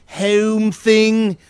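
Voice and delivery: HOME drawn out in a mock Philly accent; Philly-Pittsburgh accent. Host teasing guest about her hometown vowels, mock Philly accent. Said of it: "that fucking home and hoagie".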